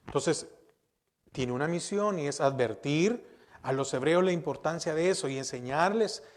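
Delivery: normal talking voice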